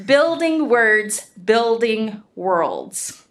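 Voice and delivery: very slow, deliberate title reading; careful second attempt. Real, not acted. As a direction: draw out "Building Words, Building Worlds", pausing between the halves. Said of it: "I'm gonna try that again".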